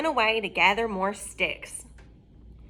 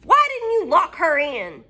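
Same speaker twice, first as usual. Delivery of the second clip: witch scream; 7 semitones higher, 6 dB louder than usual. Acted. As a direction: scream at the gate. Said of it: "she screamed".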